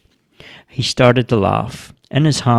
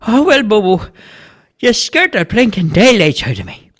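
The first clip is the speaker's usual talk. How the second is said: higher-pitched relieved man's voice; startled neighbour; 11 semitones higher than usual. A narrator's reading. Man telling Bobo he got scared, startled. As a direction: protest after a fright.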